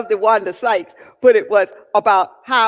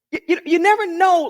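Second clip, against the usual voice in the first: normal talking voice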